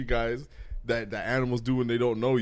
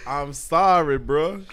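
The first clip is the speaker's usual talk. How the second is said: loud, raised-pitch mimicked apology; mock apology; 7 semitones higher, 9 dB louder, much slower than usual. Co-host mimicking the video apology for laughs, loud and high. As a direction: belt out the apology as an imitation.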